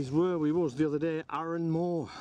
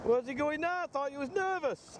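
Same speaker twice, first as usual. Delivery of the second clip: much higher, incredulous call after a mate; high-pitched teasing; 12 semitones higher than usual. Real, not acted. Kayaker to nervous mate heading in, high-pitched teasing. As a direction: tease him in a raised, high voice.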